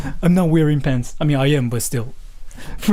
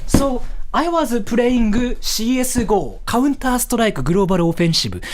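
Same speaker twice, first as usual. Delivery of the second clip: pitched-up Japanese-accented English; high-pitched Japanese accent; 4 semitones higher than usual. Shown as a demonstration. Streamer showing the voice he has to use, high-pitched Japanese accent. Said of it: "this freaking fucking high-pitched voice".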